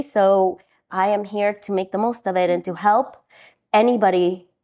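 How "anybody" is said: ordinary delivery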